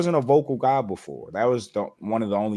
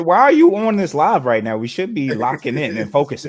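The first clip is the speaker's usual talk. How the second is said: retold [name] quote; 6 semitones higher, 8 dB louder than usual. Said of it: "he said".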